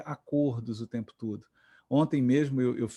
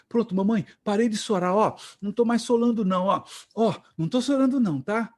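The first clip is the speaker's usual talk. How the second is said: put-on higher, louder little-kid voice; high, eager toddler reporting in; small child; 7 semitones higher, 5 dB louder than usual. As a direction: imitate a small boy insisting to his mother. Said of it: "ele tava chorando muito".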